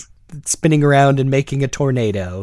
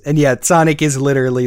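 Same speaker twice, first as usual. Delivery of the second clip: ordinary talk, nothing marked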